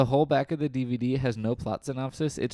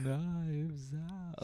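mocking low energy; 6 semitones higher, 9 dB quieter than usual. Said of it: "That was the least energetic way to say that".

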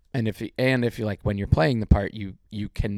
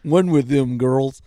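louder, slow, sassy drawl; sassy put-on voice; 4 semitones higher, 9 dB louder, slower than usual. Jokey put-on voice.

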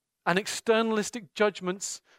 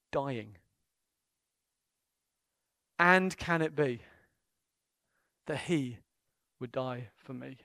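hushed awe; 8 semitones lower, 5 dB quieter than usual. Real, not acted. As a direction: drop to a hushed, halting wonder. Awed climax before outburst.

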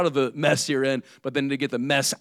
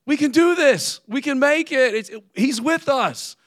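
loud, much higher cry of bold faith; rallying shout; 8 semitones higher, 5 dB louder than usual. Retold or imitated. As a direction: cheer the people on with full conviction. Joshua and Caleb rally the people.

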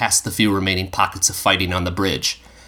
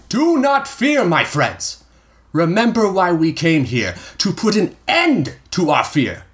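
1 rallying leader; 10 semitones higher than usual. A narrator's reading. Leader rallies his troops.

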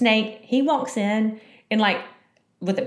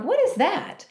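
startled; 12 semitones higher than usual. Retold or imitated. Spotting snake in hallway.

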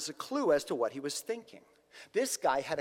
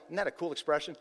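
ordinary talk

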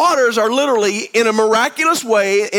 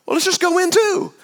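mock naive soldier; 8 semitones higher than usual. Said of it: "I wouldn't think to myself".

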